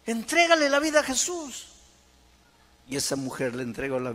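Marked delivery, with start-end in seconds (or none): 0.0-1.7 loud, high exhorting cry; preaching at full force. Real, not acted. Preacher urging a listener to leave drink, full-force exhortation. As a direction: urge them out of misery at full voice.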